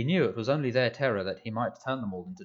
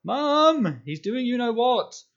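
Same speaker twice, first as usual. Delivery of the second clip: much higher, loud panicked yell; howling; 14 semitones higher, 9 dB louder, slower than usual. A narrator's reading. Dudley running to tell his mum about Harry, howling. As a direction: scream it out while running away. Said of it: "howled Dudley".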